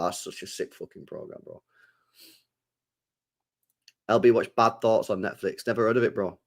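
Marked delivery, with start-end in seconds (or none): 0.0-1.6 near whisper, under the breath; breathy, barely voiced praise; muttered aside. Real, not acted. Mumbled aside.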